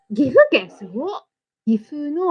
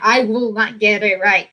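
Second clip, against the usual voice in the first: ordinary delivery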